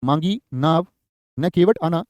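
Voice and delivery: "मांगी नाव" rising, break, then "न केवट आना" falling; pause after "नाव". Shown as a demonstration. Teacher dictating a well-known example, pausing after "नाव". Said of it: "बड़ा चर्चित उदाहरण आता है इसका".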